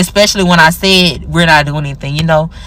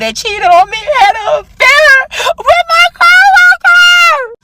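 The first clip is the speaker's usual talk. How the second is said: hammed-up wail; 5 dB louder, slower than usual. Exaggerates heartbreak while packing.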